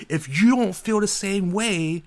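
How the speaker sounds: parodying the cashier's relentless cheer; mock toxic positivity. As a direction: ham up a blissed-out positivity guru.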